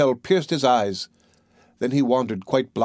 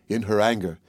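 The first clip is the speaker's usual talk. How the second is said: ordinary delivery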